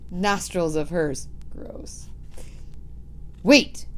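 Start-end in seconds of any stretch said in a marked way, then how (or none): 1.5-2.1 disgusted whisper; whispered aside. Real, not acted. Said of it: "narrator's own aside". 3.4-3.9 louder shouted call. Acted. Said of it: "she shouted again".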